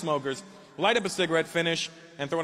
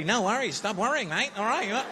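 put-on higher, quick Australian-accented ribbing; mock Aussie; 8 semitones higher than usual. Comic quoting the Australian crowd in an Australian accent. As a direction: parody the Australian reassurance. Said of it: "you have to tell everybody".

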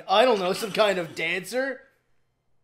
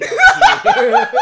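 much higher and louder, laughing falsetto; squeaky mock sob; 11 dB louder than usual. Shown as a demonstration. Repeating the villain's line as a laughing high-pitched mock sob. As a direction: squeak it like a blubbering baby. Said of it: "I'm tearing up here".

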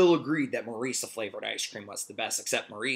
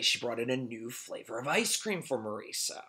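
ordinary delivery

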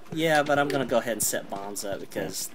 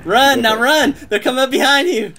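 urgent yelling; 14 semitones higher, 8 dB louder than usual. Real, not acted. Urging teammate to flee.

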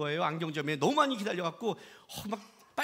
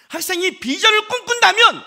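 higher, louder pulpit voice; raised preaching; 10 semitones higher, 11 dB louder than usual. Acted. Preacher lecturing students, loud and higher. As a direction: ring it out to the hall.

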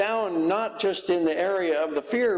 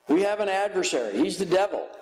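usual speaking voice

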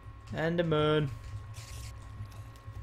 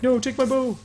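mock voice; 12 semitones higher, 6 dB louder than usual. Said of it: "he was like".

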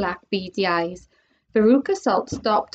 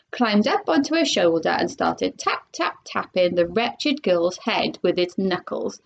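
ordinary delivery, nothing marked

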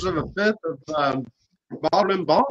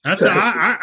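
ordinary delivery

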